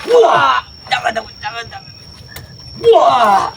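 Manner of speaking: high pained cries; pained begging. Acted. Thug cries and begs as Jakabaya hurts him. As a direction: beg in pain.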